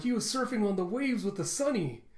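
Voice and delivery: high-pitched, wide-eyed wonder; amazed exclaiming. Retold or imitated. Chopper amazed at Jinbei surfing the Sunny, excited wonder. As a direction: marvel like an excited little crewmate.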